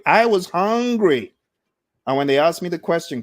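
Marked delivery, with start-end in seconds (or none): 0.1-1.3 "I was hungry" said slowly, higher and louder, leaning on "I"; emphatic declaration. Real, not acted. Coach telling listeners about his hunger, slow loud emphatic "I was hungry".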